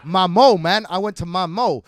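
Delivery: lightly voiced street slang; street talk. Teasing about your mum, lightly acted street talk. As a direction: give it a bit of mock street swagger. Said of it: "you were just being really street about your mum".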